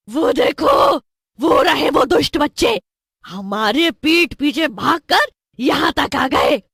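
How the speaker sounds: high, loud witch calling out; shrieky storybook villainess alarm; angry witch shout. Acted. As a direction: point out the runaways in outrage. Witch spots escaped children.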